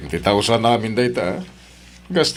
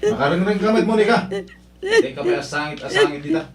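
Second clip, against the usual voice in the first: much higher, laughing-edged rebuke; exasperated scolding; 14 semitones higher than usual. Acted. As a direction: tell her off for the noise. Husband scolds her crying.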